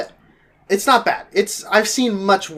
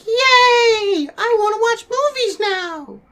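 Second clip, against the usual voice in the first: very high, squealing mock-kid excitement; all excited; slower than usual. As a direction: squeal it like a thrilled little kid. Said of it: "I get all excited".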